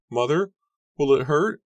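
high, loud, rising question; frightened cry. A narrator's reading. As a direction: plead with mother like a scared girl. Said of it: "Susanna cried".

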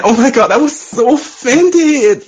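drawn-out, high mock outrage; mock offended. Caller mocking people offended by Dave Chappelle, high sarcastic whine. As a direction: fake clutching pearls, slowly and sarcastically. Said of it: "No, I'm, I'm full of shit".

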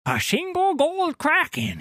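high, mocking captain voice; imitating the captain. Sailor mocking his captain to a stranger, high mock voice. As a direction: mimic the captain with a high sneer. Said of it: "he said".

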